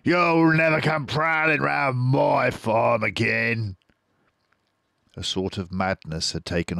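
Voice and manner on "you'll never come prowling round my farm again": Boggis yelling. A narrator's reading. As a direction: gloat down at the fox. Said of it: "yelled Boggis".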